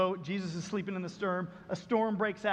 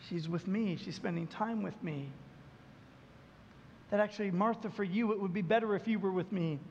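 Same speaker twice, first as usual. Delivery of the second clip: gentle God speaking; 4 semitones lower, 5 dB quieter than usual. Retold or imitated. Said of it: "And God says".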